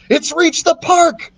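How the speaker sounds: high, slow alarmed scream. A narrator's reading. Thomas screaming to Jack as the dinosaur reaches the park. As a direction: cry it out as the tail vanishes. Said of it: "he screamed".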